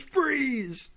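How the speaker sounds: high yelled order to halt; mock shout. Retelling the soldier's warning to the zombie, high mock shout. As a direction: bark it like a soldier on guard. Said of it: "he goes".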